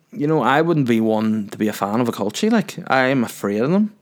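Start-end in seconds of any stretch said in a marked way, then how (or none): none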